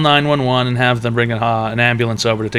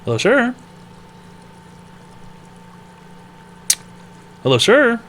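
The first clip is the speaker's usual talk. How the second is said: high call out; 9 semitones higher than usual. Acted. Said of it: "calling out to caller".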